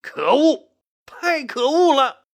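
high, outraged cry; indignant shouting. A narrator's reading. Outraged ministers crying out at the insult, indignant shouting. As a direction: cry out in indignation, drawing out the last words. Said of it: "都纷纷高声喊道".

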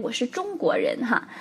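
normal talking voice

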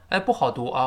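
usual speaking voice

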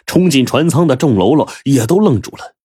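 plain reading voice

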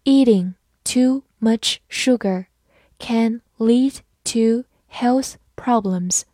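slow, careful reading for learners; slow classroom model reading; slowly. Shown as a demonstration. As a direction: space the words out slowly. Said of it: "好，慢慢来读。".

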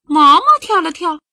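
plain reading voice

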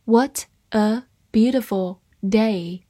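word by word, with clear gaps; slow reading. Shown as a demonstration. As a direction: read it slowly for learners. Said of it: "慢慢来读". (慢慢地逐词朗读)

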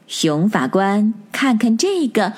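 usual speaking voice

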